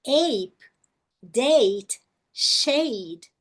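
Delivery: "Ape, date, shade" with a drawn-out ei; spaced-out demo words; long A examples. Shown as a demonstration. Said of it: "exemplos de som longo da letra A".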